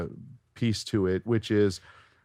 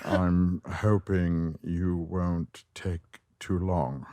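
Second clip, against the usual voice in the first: very slow, annoyed English drawl; close-mic, deliberate voice match, sinking at the end; Alan Rickman; 2 semitones lower, 3 dB quieter, much slower than usual. Shown as a demonstration. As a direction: drag each word out with weary irritation. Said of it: "sort of annoyed with everything".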